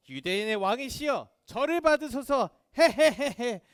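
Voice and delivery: loud mock homage ending in a jeering laugh; taunting soldier, building to a mocking laugh. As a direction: jeer at a fake king, cackling at the end. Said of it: "막 이렇게 조롱하면서".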